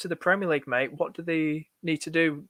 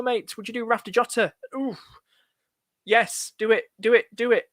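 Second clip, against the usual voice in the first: usual speaking voice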